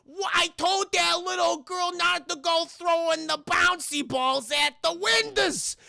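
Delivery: high, loud, slow put-on Karen yelling; angry Karen shout. Motel owner yelling about the broken window, high shout. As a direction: shriek at the kid like an angry neighbour. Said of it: "She's like".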